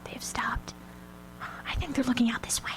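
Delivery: soft, tense whisper, no voice. Acted. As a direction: keep it under your breath, they're watching. Hiding from men at night.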